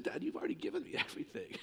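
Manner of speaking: soft, fast, breathy laugh through the words; laughing reply. Retold or imitated. Son laughing off his father's offers, soft and fast. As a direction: gently laugh it off. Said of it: "I said".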